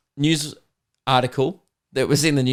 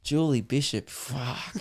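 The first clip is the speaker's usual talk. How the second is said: much softer, trailing lustful "fuck"; mock leering murmur, much softer; hushed lustful aside; 9 dB quieter, slower than usual. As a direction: breathe her name out like a leering fan. Imagined ogling of a politician.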